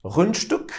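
Rundstück, attempted German; hesitant German Rundstück; German pronunciation. Shown as a demonstration. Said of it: "pewnie wypowiem to źle".

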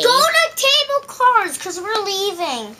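high, slow silly voice; squeaky put-on voice. Pretend customer announces they're leaving, in a slow squeaky voice.

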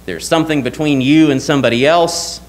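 normal talking voice

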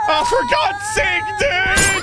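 panicked high yelling while fleeing; being chased. Real, not acted. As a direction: scream it as the dogs close in. Chased by dogs, yelling run.

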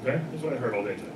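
normal talking voice

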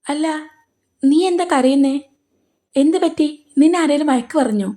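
gently alarmed, asking what's wrong; worried father. Acted. Father asks his crying daughter what happened, anxiously.

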